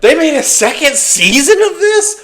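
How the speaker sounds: high, loud, laughing disbelief; mock outrage. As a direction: act scandalised that it got a second season. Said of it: "so I'm like".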